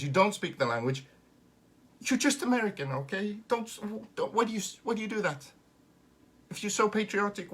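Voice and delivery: loud, high-pitched comic outburst; exasperated tirade. Acted. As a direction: burst out in protest, then keep pleading high. Comic tirade at imagined Americans.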